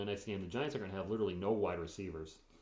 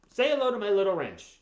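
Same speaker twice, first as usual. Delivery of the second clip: loud, high gangster movie quote; Scarface impression; 10 semitones higher, 11 dB louder than usual. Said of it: "Scarface quote parody".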